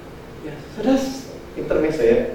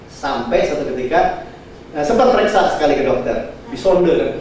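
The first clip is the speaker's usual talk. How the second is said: usual speaking voice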